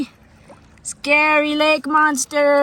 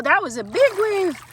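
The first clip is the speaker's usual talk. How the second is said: ordinary talk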